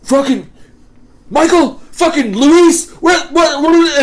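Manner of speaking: high, loud imitation of an enraged boss sputtering names; mock furious yell. Furious manager losing it.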